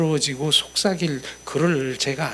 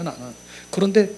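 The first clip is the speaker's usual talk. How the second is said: normal talking voice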